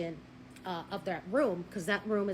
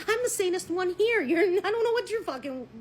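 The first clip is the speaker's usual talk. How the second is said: exasperated inner rant; 8 semitones higher, 5 dB louder than usual. Retold or imitated. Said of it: "And I'm thinking".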